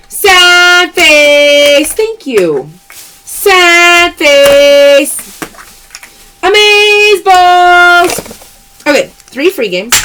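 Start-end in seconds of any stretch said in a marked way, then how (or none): 0.1-1.9 louder, drawn-out call; playful loud callout; loud catchphrase call. Real, not acted. Live sale callout. 2.9-5.2 louder, drawn-out call; playful loud callout; loud catchphrase call. Real, not acted. Live sale callout. 6.4-8.2 very slow, loud, stretched words; loud drawn-out exclamation; drawn-out cheer. Real, not acted. Live sale callout.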